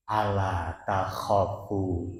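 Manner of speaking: slow, soft reassurance; gentle angel. Acted. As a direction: soothe the dying man gently. Angel's words to the dying. (malaikat menenangkan dengan lembut)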